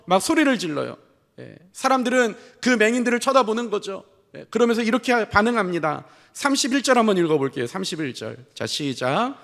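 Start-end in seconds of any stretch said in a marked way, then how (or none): none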